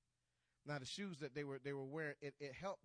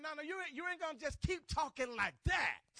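high, loud outburst as David; defiant shout; 11 semitones higher, 13 dB louder than usual. Acted. David to Goliath for mocking the armies, defiant shout. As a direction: shout down the taunting enemy, preacher-style.

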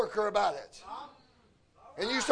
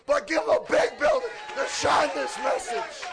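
forceful, raised-pitch sermon delivery; punchy revival preaching; fervent preaching; 4 semitones higher than usual. Real, not acted. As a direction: preach it hard to an answering congregation. Preaches to the congregation.

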